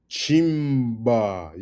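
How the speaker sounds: "chimba" with a long, punched CHIM, falling away; stress on CHIM. Shown as a demonstration. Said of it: "You got an emphasis on the chim".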